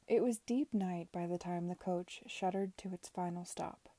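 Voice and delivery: ordinary delivery, nothing marked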